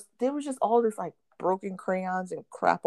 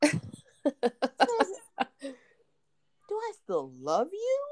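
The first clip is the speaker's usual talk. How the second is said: incredulous echo; 10 semitones higher than usual. Retold or imitated. Mom echoing son incredulous.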